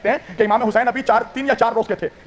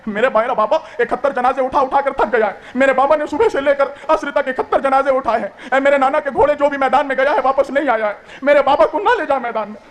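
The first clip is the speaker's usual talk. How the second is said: tearful pleading cry; lamenting plea; 5 semitones higher than usual. Acted. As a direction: plead for the father like a grieving child. Wailing voice of Sakina.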